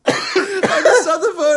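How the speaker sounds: high, slow words squeezed through real laughter; helpless with laughter, barely getting it out; laughing hard. Real, not acted. Friend retelling the phone call, laughing hard. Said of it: "I was laughing so hard".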